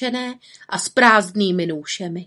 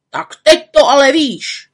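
loud, higher angry scolding; grumbling anger; 7 semitones higher, 9 dB louder than usual. Acted. Forester scolding the witch, angry and loud. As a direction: scold her hotly. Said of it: "láteřil lesník".

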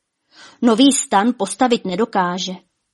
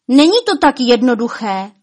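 raised, louder voice; shouting at her; 4 semitones higher, 5 dB louder than usual. A narrator's reading. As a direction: shout the point across. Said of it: "křičel na ní Lumír".

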